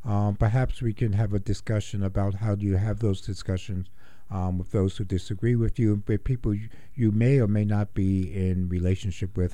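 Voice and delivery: normal talking voice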